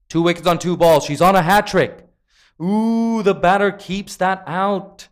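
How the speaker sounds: high, loud live cricket call; excited play-by-play. Real, not acted. Commentator calls a hat-trick ball to viewers, excited play-by-play. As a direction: lift the voice for the big ball.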